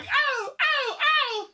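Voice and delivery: very high, loud mock cries of pain; Thomas. Imagining Thomas the Tank Engine being punched, high yelps. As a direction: yelp in a high cartoon train voice. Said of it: "you hear Thomas's voice going".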